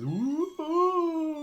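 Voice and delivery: high spooky ghost wail; ghostly wailing. A narrator's reading. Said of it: "making a wailing noise".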